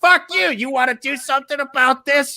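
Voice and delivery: high-pitched belligerent challenge; mock confrontation. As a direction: imitate someone squaring up for a brawl. Acts couple turning on next table.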